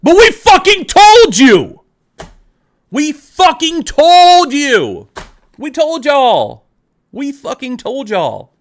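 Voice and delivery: screamed high and loud at first, then dropping back down; furious shout, fading. Real, not acted. Crashing out at David again, shouting then fading. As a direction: blow up one last time, then run out of steam. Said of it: "I'm not gonna apologize for yelling, shouting".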